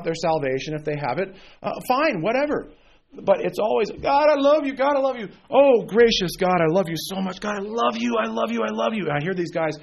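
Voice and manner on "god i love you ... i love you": a hint of a gushing worshipper, pitched higher; mock a sappy prayer, syrupy and repetitive; mocking gushy worship. Preacher mocking modern worship songs, lightly gushing. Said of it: "you almost want to throw up".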